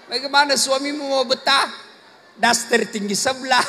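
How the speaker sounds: usual speaking voice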